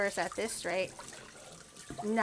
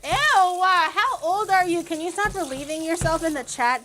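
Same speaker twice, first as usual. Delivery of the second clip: loud disgusted scolding; 5 semitones higher, 7 dB louder than usual. Pretend.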